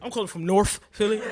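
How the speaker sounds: loud, quick mock radio caller. Teasing a North Philly audience member as a caller, loud mock radio voice. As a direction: call in to the station, loud and laughing. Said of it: "you calling the radio too".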